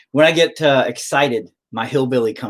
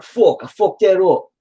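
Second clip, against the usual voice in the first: higher, louder New York swearing; New Yorker accent; 8 semitones higher, 5 dB louder than usual. Pretend. Painter cursing his own mistake, in New Yorker accent. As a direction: curse like a put-on New Yorker. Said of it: "Now I'm turning New Yorker".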